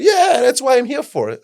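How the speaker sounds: high, loud, eager helper voice; bright yes from the helpful attendant; cheerful worker. Retold or imitated. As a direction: train attendant happily offering help, eager and loud. Helpful train worker quoted.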